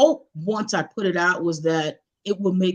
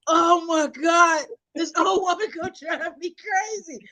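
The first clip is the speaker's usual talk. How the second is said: exasperated; 8 semitones higher than usual. Retold or imitated. Grandchild after grandma hangs up, acted exasperation. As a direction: re-enact a fed-up groan of disbelief.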